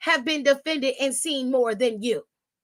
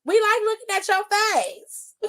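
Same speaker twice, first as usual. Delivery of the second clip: mocking taunt; 8 semitones higher than usual. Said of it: "I said".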